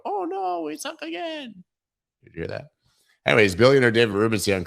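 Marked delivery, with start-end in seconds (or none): 0.0-1.6 high squeaky whine, soft at the end; mock whiny quote. Streamer imitating a chat sound clip, high squeaky whine. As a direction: squeak it like a sad fan. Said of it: "Did it go".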